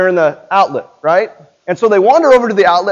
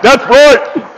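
louder emphatic agreement; mock approving parent; 5 semitones higher, 8 dB louder than usual. Strict parents agreeing with the preacher, emphatic. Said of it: "some parents are like".

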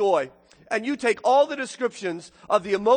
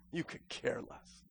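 whisper; 6 semitones lower, 17 dB quieter than usual. Real, not acted. Sermon, sudden whisper.

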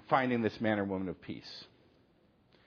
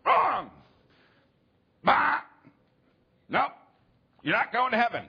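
high, loud game-show host yelling wrong; game show buzzer shout; 11 semitones higher, 6 dB louder than usual. Retold or imitated. Kids shouting at friends like a game show host, loud and gleeful. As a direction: blast it like a buzzer going off on a quiz show. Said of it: "Like it's a game show".

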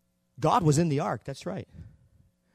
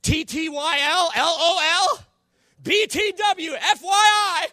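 rising higher and louder letter by letter; mock gleeful texting; 7 semitones higher, 5 dB louder, slower than usual. Philistines gloating over victory, mock excited. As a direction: gloat like a happy winning army. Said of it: "They were shouting".

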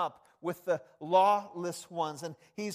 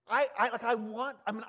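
normal talking voice

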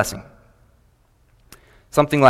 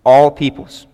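"All peoples" punched louder; stress on all peoples; 5 dB louder, slower than usual. Shown as a demonstration. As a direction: lean hard on "All peoples". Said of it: "the emphasis on some of these, uh, words".

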